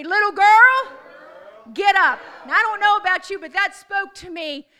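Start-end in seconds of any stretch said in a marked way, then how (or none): 0.0-2.2 leading a call-out. Shown as a demonstration. Preacher leading the congregation in unison, loud. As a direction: shout it so they join in. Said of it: "somebody say it with me".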